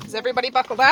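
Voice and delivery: loud call to the back seat. Real, not acted. Calling to kids in the van.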